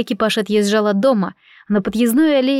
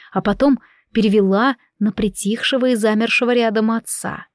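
ordinary delivery

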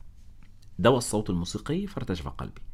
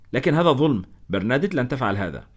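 louder, higher outburst of protest; protesting shout; 4 semitones higher, 4 dB louder than usual. Acted. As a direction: blurt it out against the injustice. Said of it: "فقدت حذري وصحت". (صيحة احتجاج عالية)

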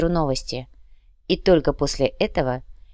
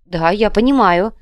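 slow, high, loud eager assent; joyful; 4 semitones higher, 7 dB louder than usual. A narrator's reading. Hamzi hastens to agree with his captor, joyfully. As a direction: agree with relieved delight. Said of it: "радостно ответил Хамзи".

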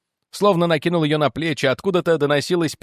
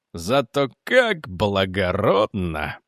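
slow, low sneer of distaste; disgusted sarcasm; 6 semitones lower, much slower than usual. A narrator's reading. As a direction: draw it out low, with contempt. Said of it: "процедил Маргрейвс с инстинктивным отвращением". (процедить медленно, с отвращением)

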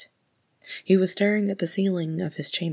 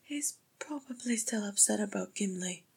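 higher, hushed whisper; soft, airy aside; 5 semitones higher, 7 dB quieter than usual. A narrator's reading. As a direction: whisper it timidly from the back. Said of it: "whispered Frerin from the back of the room".

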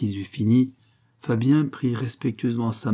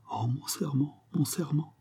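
higher, much softer murmur; stricken murmur; 4 semitones higher, 7 dB quieter than usual. A narrator's reading. Fabien, clinging to a chair so as not to fall, murmuring. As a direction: murmur it faintly, as if overwhelmed. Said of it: "et murmura".

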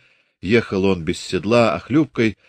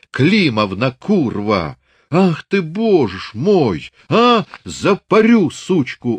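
high, loud, cracking rant; furious old man's shout; 6 semitones higher, 4 dB louder, slower than usual. Acted. Father raging at the lying neighbour woman, shouting wildly. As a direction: rage and stamp, voice flying up.

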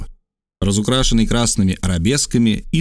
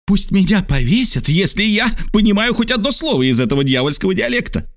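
high, exasperated exclamation; irritated outburst; 10 semitones higher than usual. A narrator's reading. Geographer baffled by the native's dialect, exasperated high outburst. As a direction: vent the frustration sharply. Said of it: "с видимым раздражением".

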